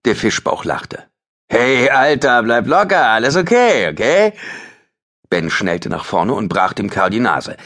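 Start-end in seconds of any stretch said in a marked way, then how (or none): 1.5-4.3 higher, louder sneering brush-off; mocking thug. Teen thug mocks Ben to stay cool, higher and louder. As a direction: laugh him off as harmless.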